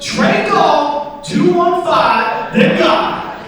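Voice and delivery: loud, high, drawn-out announcement; mugger calling out. Acted. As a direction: holler each part slowly. Mugger calls out his Venmo name.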